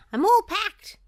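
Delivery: high, loud excited shout; Goofy shouting. A narrator's reading. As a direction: shout it out, thrilled to go. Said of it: "he shouted".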